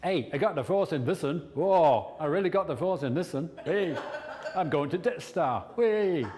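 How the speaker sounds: silly put-on hero voice; Luke Skywalker. Coach spoofs a Star Wars hero, silly excited voice. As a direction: whoop it up as a daft, giddy hero. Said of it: "Or Luke Skywalker".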